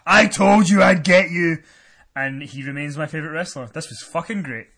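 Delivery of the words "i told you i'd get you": loud, slow retold wrestler's yell; mock scream. Recapping Strowman's win over Elias, imitated scream. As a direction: bellow it in imitation of a triumphant wrestler. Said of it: "and then screams".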